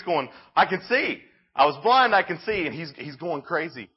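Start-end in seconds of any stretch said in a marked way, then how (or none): 0.5-2.6 high, loud jubilant shouting; acted overjoyed outburst; excited acted cry. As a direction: call out your amazement, pitched high. Healed man running through the streets.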